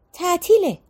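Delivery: تعطیله said high, falling at the end; insistent repeat. A narrator's reading. Repeats after being challenged "what?.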